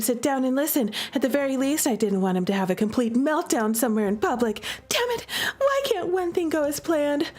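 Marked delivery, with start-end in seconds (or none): 4.9-7.3 high, exasperated cry of frustration; frustrated outburst. Acted. Heroine chasing her runaway brother, exasperated. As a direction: vent it as the plan falls apart.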